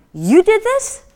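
high, loud astonished question; can't believe she made this; amazed disbelief. Retold or imitated. Buyer stunned that the inventor made it, high astonished question.

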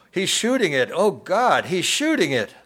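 high, loud horrified outcry; woman's screech. A narrator's reading. Woman in the dining room as the bear is shot, screeching. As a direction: cry out in alarm, pitch leaping up. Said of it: "A woman screeched".

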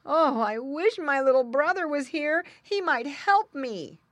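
high, loud little-pig lament; plaintive piglet. A narrator's reading. Tied-up pig wishing for his brother, plaintive.

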